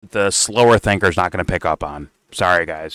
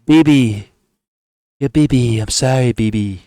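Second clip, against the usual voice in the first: higher, pleading hammed-up Trump; mock apologetic Trump; 4 semitones higher, 5 dB louder than usual. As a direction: play Trump grovelling on the phone. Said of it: "Did he call him up and say".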